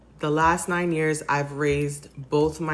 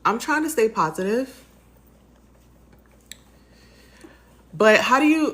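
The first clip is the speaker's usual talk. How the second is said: higher and cracking through tears; voice breaking; 8 semitones higher, 6 dB louder than usual. Real, not acted. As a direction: try to sound upbeat while the tears break through. Breaking down on camera.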